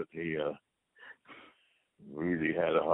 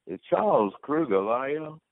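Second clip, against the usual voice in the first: higher, louder mock wife on the phone; imitating the wife, more insistent; 9 semitones higher, 4 dB louder than usual. Wife phoning yet again, hammed-up insistent voice. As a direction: push it up, pestering, calling again. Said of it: "she'd say".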